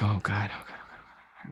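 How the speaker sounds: hushed panic under the breath; soft whispered dread; panicked whisper. Retold or imitated. Panicking as call comes.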